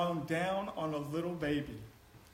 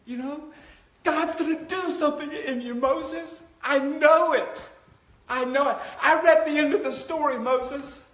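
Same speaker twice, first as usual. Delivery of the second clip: loud, high preaching cry; fiery sermon climax; preaching at full force; 8 semitones higher, 7 dB louder than usual. Acted. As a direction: preach it out to the back row. Preaching climax about Moses.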